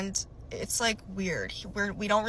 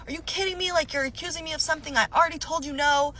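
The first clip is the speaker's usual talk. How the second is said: high, indignant retort; pissed off; 7 semitones higher, faster than usual. Retold or imitated. Accused boyfriend denies it to girlfriend, pissed off. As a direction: imitate an angry boyfriend snapping back. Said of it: "And he's pissed. He's cussing at me".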